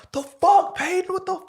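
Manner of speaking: high-pitched mock yell of angry teammates; high, loud mocking of furious teammates. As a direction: squeal it like the whole team's mad at you. Teammates yelling after dropped ball.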